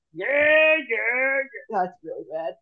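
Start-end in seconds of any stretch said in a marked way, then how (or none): none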